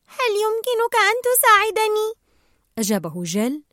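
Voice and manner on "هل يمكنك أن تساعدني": put-on high, strained young fish voice; struggling plea. A narrator's reading. Nemo asks Gill for help, struggling, high-pitched plea. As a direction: beg for help in a raised little voice. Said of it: "سأله نيمو في معاناة".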